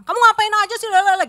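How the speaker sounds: high, loud nagging accusation; mock angry accusation. Wife accusing her husband, high loud nagging.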